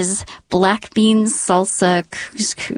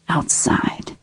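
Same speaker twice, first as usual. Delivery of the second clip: hushed; 5 dB quieter than usual. Retold or imitated. Hearing a bear outside.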